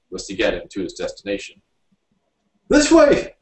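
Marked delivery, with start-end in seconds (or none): 2.7-3.4 very high, loud shriek; shrieked directions. A narrator's reading. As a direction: shriek the way, frantic. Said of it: "he shrieked".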